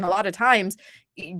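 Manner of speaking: ordinary delivery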